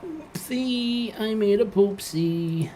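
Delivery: put-on high, sing-song baby voice; baby talk. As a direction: coo it like a toddler. Baby talk after a mistake.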